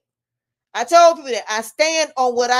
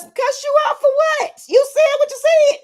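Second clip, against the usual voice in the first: much higher and louder, in a put-on voice; mocking incredulous voice; 13 semitones higher, 6 dB louder than usual. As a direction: squeak it out in disbelief, mocking them. Mocking angry person in quote.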